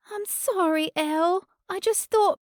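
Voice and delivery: a crumbling plea to him; Cady's broken apology. Acted. Cady crumbles after the attack.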